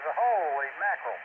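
usual speaking voice

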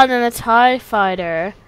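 ordinary talk

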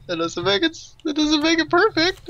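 high, squeaky mockery; mocking high voice. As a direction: tease in a squeaky pitch.

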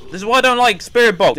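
loud, high frightened outburst; real shocked yell; startled shout. Real, not acted. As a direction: yell it right after the scare. Jump scare from Spirit Box.

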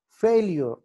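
Failure with a high, stressed "fail"; failure, high start, falling end. Shown as a demonstration. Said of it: "F దగ్గర".

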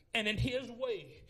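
normal talking voice

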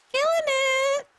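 very high, loud, held-out squeal; silly high squeal. Pretend. Streamer boasting of killing it, silly high squeal. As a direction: squeal it out in mock triumph.

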